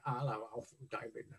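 retold shy introduction; timid greeting. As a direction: mumble your name nervously.

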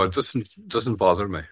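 usual speaking voice

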